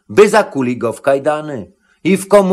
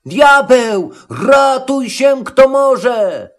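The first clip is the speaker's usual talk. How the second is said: high-pitched panicked shout; acted terror of fleeing robbers; terrible cry; 9 semitones higher, 3 dB louder, slower than usual. A narrator's reading. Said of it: "Nagle strasznie zakrzyknęli".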